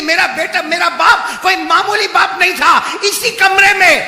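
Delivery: imitating a loud, high outcry; retold cry. Daughter proclaims her father was no ordinary man, retold cry. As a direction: cry it out at preaching volume. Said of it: "وہ بیٹی چیخ مار کے کہتی ہے".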